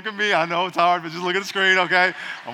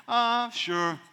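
soft, noncommittal mumble; half-hearted child's "sure"; mock lukewarm kid; 2 semitones higher, 5 dB quieter than usual. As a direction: shrug it off. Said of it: "when I ask my kids".

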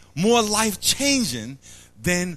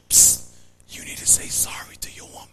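fast secretive whisper; a quick hushed nudge from God; faster than usual. Acted.

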